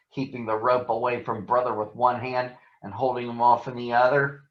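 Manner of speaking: ordinary delivery, nothing marked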